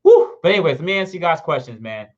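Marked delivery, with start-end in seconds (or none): 0.0-0.4 short, high, loud woo; excited whoop. Real, not acted. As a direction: burst out with a joyful whoop. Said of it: "I'm so freaking excited".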